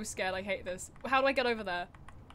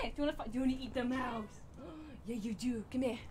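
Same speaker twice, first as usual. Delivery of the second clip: ordinary talk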